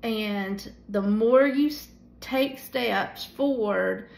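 usual speaking voice

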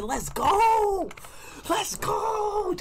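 very high, drawn-out victory cry; elated cheer. Real, not acted. Streamer celebrating an argument won while whispering, elated.

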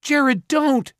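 high, loud warning cry; desperate high shout; yelling. A narrator's reading. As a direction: yell to stop him. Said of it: "Simon yelled".

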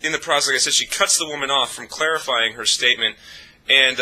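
ordinary delivery

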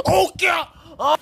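high startled cry; startled yelp. Acted. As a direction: yelp in sudden shock. Dad startled.

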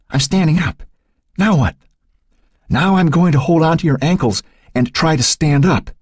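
lightly voiced boy giving directions in the dark; Matt's voice. A narrator's reading. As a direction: coach her up onto his shoulders, a boy trying to sound sure. Matt guiding Becky.

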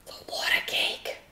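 soft, voiceless whisper; whispered aside. Real, not acted. Aside while pouring batter.

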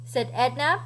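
ordinary delivery, nothing marked

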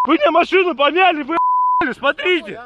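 high, angry yelling; shouting match. Real, not acted. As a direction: yell it in outrage. Driver yelling after car damaged.

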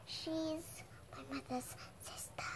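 a quick breathy whisper, voice dropped right down; whispered aside. Real, not acted. Child whispering to the listener who Ninang is. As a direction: lean in and whisper it as a side note.

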